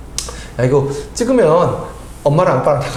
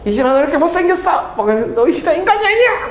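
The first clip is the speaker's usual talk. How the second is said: high, shrill-free hammed-up teacher scolding, much higher; mock insult; 13 semitones higher than usual. Teacher jokingly mocks a student he would never insult, high mock voice. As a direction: pretend to insult a student in a squeaky mock voice, laughing. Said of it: "막 내가 이러는 거 아니잖아".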